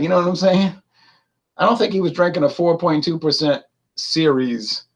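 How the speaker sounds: usual speaking voice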